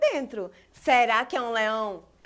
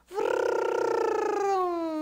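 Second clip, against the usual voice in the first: car engine noise; 4 semitones higher than usual. Acted.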